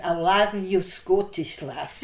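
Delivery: loud imitation of a Scottish nurse; Glaswegian nurse. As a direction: put on a light Glasgow accent, starting loud. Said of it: "Glaswegian Scottish".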